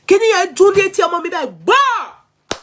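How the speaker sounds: high and loud, rushing; agitated outburst. Real, not acted. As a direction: burst out, reliving the bang. Recalling banger blast near child.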